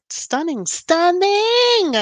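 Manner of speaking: excited gushing. Real, not acted. Gushing over a dress.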